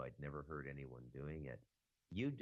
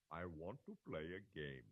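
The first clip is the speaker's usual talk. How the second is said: a light imitation of the creepy Saw game voice; hint of a sinister puppet-master announcement; Saw movie voice. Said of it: "it's very similar to the voice they play".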